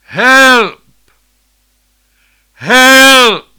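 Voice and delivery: high, loud screaming call; desperate cry for help. Retold or imitated. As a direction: yell for help like a drowning man. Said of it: "And it went like this".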